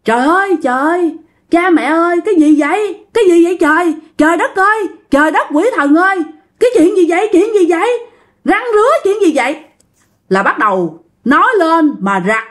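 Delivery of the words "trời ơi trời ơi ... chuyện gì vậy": a retold scream of fright, much higher, rushing at the end; panicked outcry. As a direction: cry out in sudden panic as if something grabbed you. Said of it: "để [name] dịch lại bằng cái tiếng miền Tây của [name] nha".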